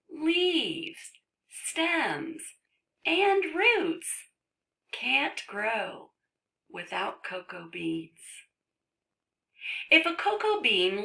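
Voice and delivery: lilting list, then animated story reading; reading to children. Acted. Reads book to children.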